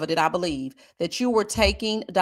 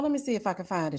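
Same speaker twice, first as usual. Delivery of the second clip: usual speaking voice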